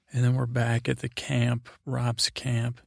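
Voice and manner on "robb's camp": ordinary talk, nothing marked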